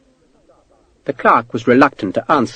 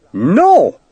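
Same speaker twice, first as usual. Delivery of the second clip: sudden higher, louder blurted No!; too loud; 7 semitones higher, 4 dB louder than usual. Acted. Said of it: "the clerk said too loud".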